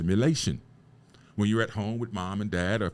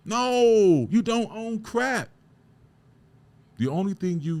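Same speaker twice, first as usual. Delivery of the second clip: passionate shout; 12 semitones higher, 6 dB louder, slower than usual. Real, not acted. Heated rant climax.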